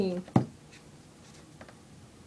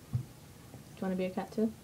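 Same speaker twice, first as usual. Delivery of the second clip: ordinary delivery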